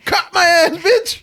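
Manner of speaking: loud, high laughing cat voice; high, shouted comic animal voice; caught cat. Retold or imitated. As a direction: voice a busted cat through laughter. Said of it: "motherfucker looks up like".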